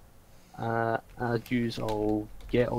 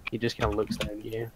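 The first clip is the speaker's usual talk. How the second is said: ordinary talk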